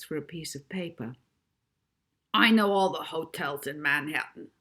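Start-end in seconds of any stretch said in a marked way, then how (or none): 2.3-4.5 boastful. A narrator's reading. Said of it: "boasted the driver".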